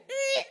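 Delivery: high, loud imitated honk; loud high horn noise; car horn. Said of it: "make a point with your horn".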